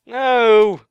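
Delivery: loud, high wail of no; dismayed cry. Real, not acted. Gamer finds things gone, dismayed wail. Lost items after dying.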